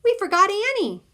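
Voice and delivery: high, loud yell to stop. A narrator's reading. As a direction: yell to halt the song. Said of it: "and yells".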